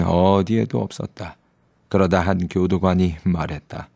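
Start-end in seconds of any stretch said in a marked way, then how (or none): none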